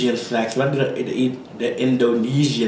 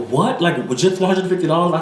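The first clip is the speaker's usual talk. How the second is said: mild surprise, pitched up; retold shock; 5 semitones higher than usual. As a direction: replay a shocked reaction to the bill. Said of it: "I was just so shocked".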